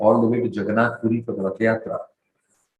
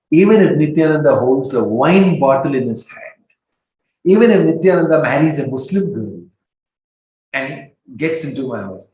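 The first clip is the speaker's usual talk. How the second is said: loud, raised-pitch firm declaration; emphatic vow of loyalty; 4 semitones higher, 6 dB louder than usual. Acted. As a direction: refuse firmly, building it in big emphatic phrases. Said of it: "said this very firmly".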